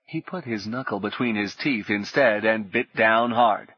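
plain reading voice

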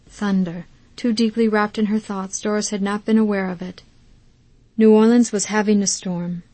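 ordinary delivery

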